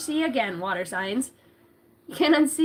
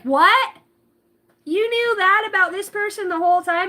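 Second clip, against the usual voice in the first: high, loud disbelieving question; mock shock; 7 semitones higher, 5 dB louder than usual. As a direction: act stunned and outraged. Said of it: "Everybody's like".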